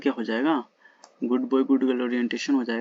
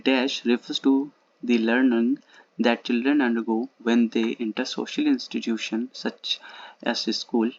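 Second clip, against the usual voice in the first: very slow, word by word dictation; teacher reading the question at a crawl; much slower than usual. Shown as a demonstration. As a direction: read it out slowly so students can follow. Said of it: "मैं अगर slow बोल रहा हूँ, धीरे धीरे बोल रहा हूँ".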